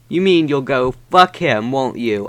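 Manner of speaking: ordinary talk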